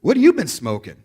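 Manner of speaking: high, loud startled "Woo!" then a teasing question; hammed-up shocked airport agent; mock surprised TSA agent. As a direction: play the agent catching a whiff of smoke. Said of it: "and she's like".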